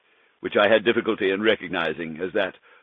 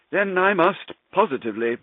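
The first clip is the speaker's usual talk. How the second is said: Montresor, mock concern; 9 semitones higher than usual. Narrator taunting his chained friend, mock concern. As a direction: feign worry while trapping him. Montresor's mocking speech to Fortunato.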